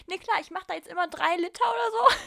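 laughing. Real, not acted. Daughter joking to parents about three liters, laughing. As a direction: crack up while answering. Telling own joke answer.

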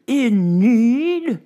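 high, loud outraged shriek; lady shrieking in fury. Acted. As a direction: scream the insult back. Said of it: "shrieked the lady".

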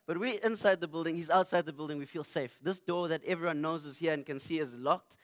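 ordinary talk, nothing marked

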